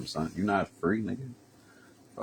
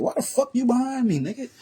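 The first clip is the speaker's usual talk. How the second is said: very high, louder, rising panic; spooked cop, voice climbing higher in panic; mock panicked cop; 5 dB louder than usual. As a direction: squeal it out like a freaked-out cop. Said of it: "That's exactly what I'm thinking".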